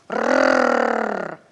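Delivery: very low, loud growl; puppy growl. Acted. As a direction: growl like a big dog. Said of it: "зарычал".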